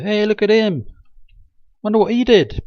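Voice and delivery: high, loud excited gawking; gawking tourist. Pretend. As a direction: point and call out like a thrilled sightseer. Imagined ride visitor gawking at hell.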